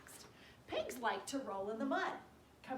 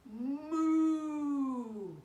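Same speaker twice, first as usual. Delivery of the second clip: very slow, louder drawn-out moo; cow moo; 4 semitones higher, 10 dB louder than usual. Acted. Puppy George mooing, slow and long. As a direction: stretch it out like a cow. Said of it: "George went moo".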